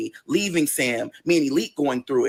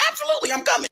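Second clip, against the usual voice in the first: eager yes; much faster than usual. Retold or imitated. Boyfriend accepts eagerly.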